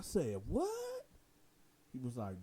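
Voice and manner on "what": sharp high shocked "What?"; stunned high yelp of surprise. Retold or imitated. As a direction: jump way up in total disbelief. Hears the guard slept with his wife.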